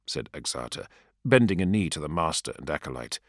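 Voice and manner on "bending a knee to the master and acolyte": ordinary delivery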